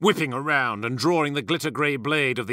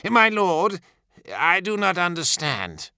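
higher-pitched, questioning officer; pitched-up plea for understanding; puzzled subordinate; 6 semitones higher than usual. A narrator's reading. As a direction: voice a bewildered officer addressing his lord. Eidolon questions his primarch.

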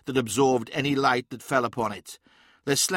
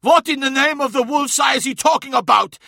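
loud, high-pitched indignant outburst; outraged shouted question; angry demand; 12 semitones higher, 8 dB louder than usual. A narrator's reading. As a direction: demand an answer at full voice. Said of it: "demanded Skarsen".

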